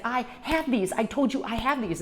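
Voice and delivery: irritated man insisting; angry insistence. Retold or imitated. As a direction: hammer home the point, annoyed. Partner insists during quarrel.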